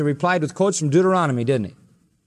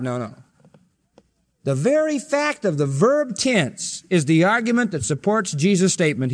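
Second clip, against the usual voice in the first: normal talking voice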